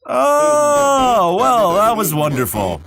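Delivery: high, loud stretched yawn easing into speech; hammed-up yawn. Host wrapping up dinner for his guest, hammed-up yawn. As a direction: fake a big satisfied yawn while talking.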